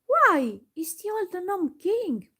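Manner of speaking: high, loud startled cry of recognition; a girl suddenly recognising her old enemy, exclaiming high and loud; exclaiming in surprise. A narrator's reading. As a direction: blurt it out, shocked to see him. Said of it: "Dorothy exclaimed".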